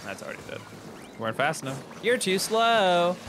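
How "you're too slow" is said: high, loud, drawn-out jeer; teasing taunt. Real, not acted. Player teasing teammate for being late, taunting. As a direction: stretch it out as a taunt.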